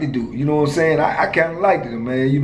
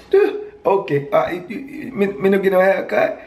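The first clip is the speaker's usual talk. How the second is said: put-on raised, high-pitched Virgin Islands accent, brushing off the haircut; 6 semitones higher than usual. Prison friend refusing a haircut, in a raised Virgin Islands accent. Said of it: "I liked his accent".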